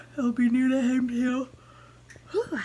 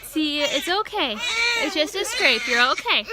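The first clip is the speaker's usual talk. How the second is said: high, loud comforting voice; mother soothing her son's scraped foot; 11 semitones higher, 5 dB louder than usual. Real, not acted. Comforting hurt toddler.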